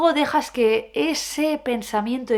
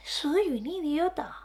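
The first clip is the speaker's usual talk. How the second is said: higher and much softer; soft high little voice; 6 semitones higher, 7 dB quieter than usual. Shown as a demonstration. Showing the thought in a soft small voice. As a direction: murmur it gently and slowly.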